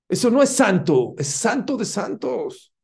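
fervent climax. Real, not acted. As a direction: build to a fervent peak. Repeats the contrast at the climax.

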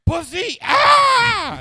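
very high, loud shriek; screaming crackhead. Retold or imitated. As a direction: shriek it up high as she leaps. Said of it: "She's like".

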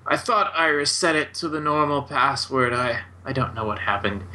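a stammering, flustered old wizard, lightly voiced; stuttering old wizard. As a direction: stammer out the apology nervously. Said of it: "mumbling and sort of stuttering".

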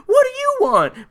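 high-pitched imitated scream; mock scream. Vice principal screaming at her killer, mock scream. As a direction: squeal it as a mock victim's cry. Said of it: "All she does manage to scream".

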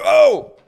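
very high, loud whoop; excited cry. Retold or imitated. Guy at the bar cheering as the cousin arrives, high whoop. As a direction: burst out like a thrilled crowd. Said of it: "he was just, like".

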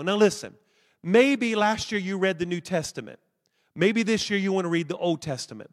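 ordinary talk

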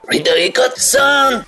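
slow, exaggerated anime villain call; voz de vilão de anime. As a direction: stretch it out like a dramatic villain. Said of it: "fala aí com voz de vilão". (voz de vilão do Naruto)

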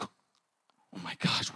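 low, slow whisper; retold stunned reaction. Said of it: "And I was like".

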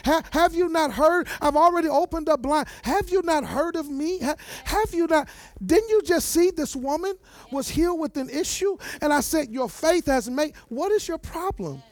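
fiery preaching. Acted. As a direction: preach it at full force. Preaching at full force.

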